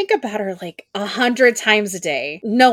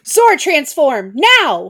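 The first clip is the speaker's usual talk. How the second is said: loud, high and slow battle order; command shout; 7 semitones higher, 6 dB louder, much slower than usual. Acted. Ranger ordering the Zords to transform, slow loud shout.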